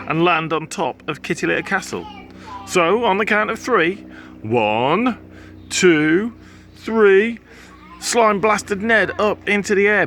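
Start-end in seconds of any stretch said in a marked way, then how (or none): 2.7-7.4 rising higher and louder to three; building countdown. A narrator's reading. Narrator counting down the slime cannon launch.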